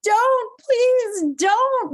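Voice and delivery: high, loud pleading scream; shrill-pitched, loud plea for mercy; terrified scream. Acted. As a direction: scream in panic as the prey. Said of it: "Wendell screamed".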